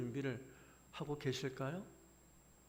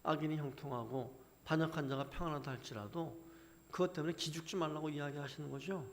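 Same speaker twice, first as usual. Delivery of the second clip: ordinary talk, nothing marked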